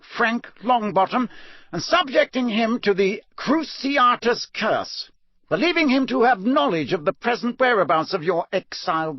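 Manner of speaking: high, hard voice of a stern judge; stern judge pronouncing the charges. Acted. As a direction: read the charges like a cold magistrate. Crouch passing sentence.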